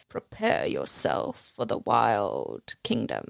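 low, drawn-out mock announcer line; slow, deep, playful proclamation; lightly imitated film dad. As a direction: intone it low and slow, hamming it up. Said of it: "when he's like".

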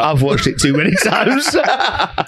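high giggly laughing speech. Real, not acted. Laughing while admitting something.

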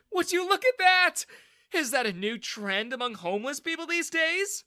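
loud, high-pitched jeer; mock him at the top of your voice; sneering. A narrator's reading. Bully jeering at a drenched rival, sneering. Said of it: "Daniel sneered".

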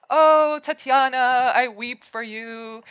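drawn-out, high mock-tragic wail; mock lament. Voicing Pushkin pitying his love-struck heroine, mock lament. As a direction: lament with hammed-up pity, starting loud and high.

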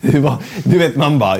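normal talking voice

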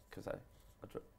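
unvoiced hushed whisper. Real, not acted. A hungover co-host confessing his drinking, a breathy whisper. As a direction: breathe it out like a secret.

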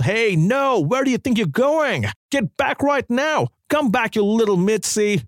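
high, chasing yell, mock-angry; yelled after her. A narrator's reading. Predator yelling after the fleeing girl, high mock yell. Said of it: "he yelled".

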